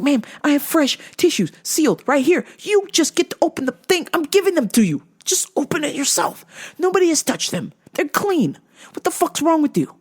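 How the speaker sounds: high-pitched mock exasperation; exasperated. Store worker offering fresh tissues to a mother, exasperated. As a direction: plead with her in retold, high-pitched disbelief.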